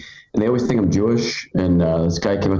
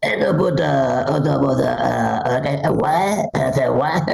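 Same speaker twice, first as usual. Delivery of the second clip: higher-pitched babbling baby impression; baby Donald Trump; 5 semitones higher, slower than usual. Comic imagines Trump as a baby, babbling. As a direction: babble like an annoying infant politician. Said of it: "see Trump as a baby".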